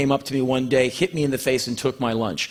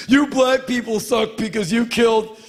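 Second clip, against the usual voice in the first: mock angry kid; 9 semitones higher, 3 dB louder than usual. Hypothetical racist retort.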